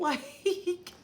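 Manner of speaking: bright laugh. Real, not acted. Laughs at comment.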